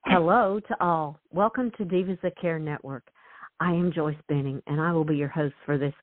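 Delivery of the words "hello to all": normal talking voice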